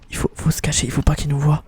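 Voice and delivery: low, soft stealthy whisper; hushed whisper. Pretend. Player telling himself to hide, hushed whisper. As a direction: keep it down so you stay hidden. Sneaking, hiding from the enemy.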